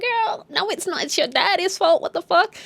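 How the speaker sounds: high-pitched sassy disbelief; incredulous. Pretend. Vlogger answers Sarah blaming Joyce, incredulous. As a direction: talk back in mock disbelief. Narrator's incredulous reply to accusation.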